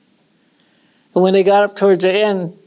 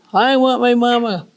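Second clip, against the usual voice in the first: put-on whiny little girl; 4 semitones higher than usual. Little one whining for mama.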